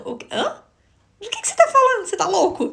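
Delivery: high-pitched incredulous mock questions; questioning disbelief. Woman thinking back at her accuser, high and incredulous. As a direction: act out a baffled "what?", voice shooting up. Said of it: "ponto de interrogação".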